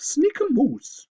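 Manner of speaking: high, slow scoffing owl voice; slow mocking owl; huffy owl. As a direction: scoff at the idea. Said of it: "Liam huffed".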